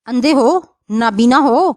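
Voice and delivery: ordinary delivery, nothing marked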